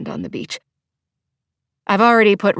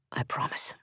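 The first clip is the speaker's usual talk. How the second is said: whisper in her ear; 10 dB quieter than usual. A narrator's reading. Comforting her with his mouth at her ear, whispered. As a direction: breathe the promise into her ear.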